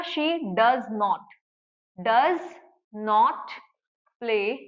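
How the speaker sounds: slow, each word set apart with pauses; word-by-word dictation. Shown as a demonstration. Teacher dictating to students, slow and spaced. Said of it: "builds the negative word by word".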